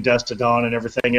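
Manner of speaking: normal talking voice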